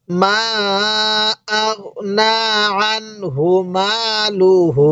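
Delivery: slow recitation with long held vowels; measured, drawn-out Quran reading; read-along recitation. Shown as a demonstration. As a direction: lead the student syllable by syllable, stretching vowels. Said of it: "আমার সাথে পড়ুন".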